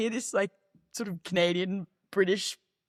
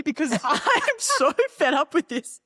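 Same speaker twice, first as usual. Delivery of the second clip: higher, laughing retold complaint; playfully fed up, pitched up; mock exasperation; 7 semitones higher, 4 dB louder than usual. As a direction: gripe with a laugh breaking in. Acts out exasperation during the race.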